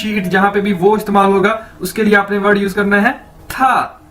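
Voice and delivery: ordinary talk, nothing marked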